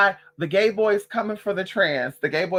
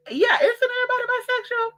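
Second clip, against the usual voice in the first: high put-on voice, fake innocent; squeaky high rhetorical question; mock innocent. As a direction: ask it like it's obvious.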